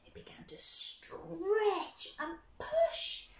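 slow, high whisper; straining whisper. A narrator's reading.

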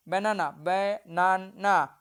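বানানা said whole, then slowly as ba-na-na; teacher sounding out বানানা; word, then syllables. Shown as a demonstration. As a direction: say বানানা, then split it into three beats. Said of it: "এইভাবে কিন্তু ভাঙা".

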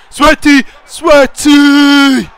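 high, loud shouts, the last drawn out; excited goal yell. Real, not acted. Gamer calling for a sweaty goal, excited high yelling. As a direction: holler it as the goal goes in.

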